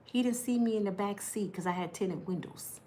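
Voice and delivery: ordinary delivery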